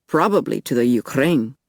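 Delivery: put-on offhand Russian-accented guess; lightly voiced Russian man. A narrator's reading. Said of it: "he asked with a Russian accent".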